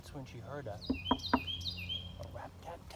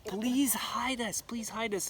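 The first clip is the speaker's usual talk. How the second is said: slow, higher pleading; 8 semitones higher, 3 dB louder, slower than usual. Acted. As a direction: draw out a desperate plea. Girl begs tree again.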